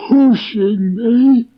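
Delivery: slow, high, gloomy Eeyore voice. A narrator's reading. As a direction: drag it out like a gloomy old donkey. Eeyore explains his dive.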